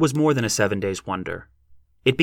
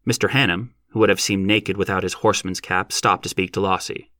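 usual speaking voice

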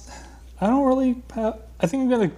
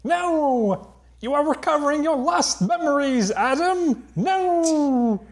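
high, hammy cartoon villain rant; Skeletor; 9 semitones higher, slower than usual. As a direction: rage like a foiled cartoon villain.

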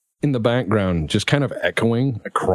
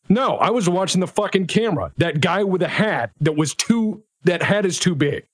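angry ranting officer; 8 semitones higher than usual. Acted. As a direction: rant at them, outraged.

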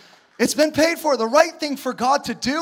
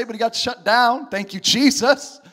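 normal talking voice

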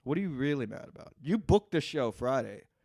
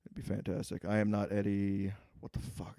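usual speaking voice

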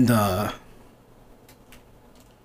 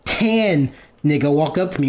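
normal talking voice

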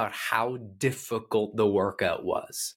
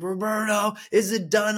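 high-pitched strained plea; imitated struggling client; 10 semitones higher, 4 dB louder than usual. Blindfolded client calling to her coach, high mock plea. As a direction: mimic a client desperate to be finished.

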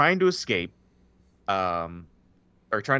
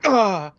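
sudden high yelp; mock yelp; 9 semitones higher, 5 dB louder than usual. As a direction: yelp as if caught naked. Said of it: "and he's like".

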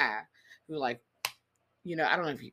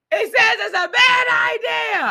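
mock yell; 12 dB louder, slower than usual. Mocking the husband yelling the warning.

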